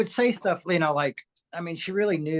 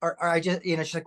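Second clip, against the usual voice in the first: ordinary delivery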